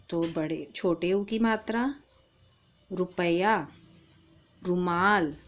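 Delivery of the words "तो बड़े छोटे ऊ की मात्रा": usual speaking voice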